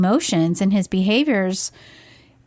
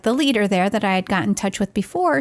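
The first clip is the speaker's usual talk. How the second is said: ordinary talk, nothing marked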